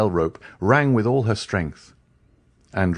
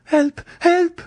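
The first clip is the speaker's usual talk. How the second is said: high, loud screams for help; cry for help; 4 dB louder than usual. A narrator's reading. Mademoiselle d'Armilly ringing the bell, screaming for help. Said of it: "cried Mademoiselle d'Armilly".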